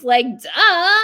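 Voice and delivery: loud, mocking duh; retold know-it-all scoff; sarcastic duh. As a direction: say it as if blindingly obvious. Retold sarcastic reply to doctor.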